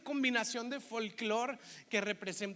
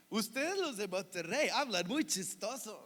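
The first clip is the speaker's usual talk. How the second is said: sing-song imitation of Monterrey accent; put-on cantadito Monterrey accent. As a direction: tease in a lilting mock Monterrey accent. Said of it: "notan ese acento así como golpeadón, cantadito".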